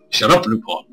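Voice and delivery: ordinary delivery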